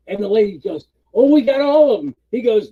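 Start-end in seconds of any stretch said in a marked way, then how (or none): 1.1-2.2 high, loud and upbeat; mock-enthusiastic meeting reply; eager employee. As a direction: pipe up proudly, imitating the lady. Lady boasts in retold meeting.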